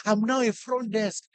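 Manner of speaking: soft, retold sheepish apology; mock apology. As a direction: shrink back into a quiet apology. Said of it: "what's wrong with you".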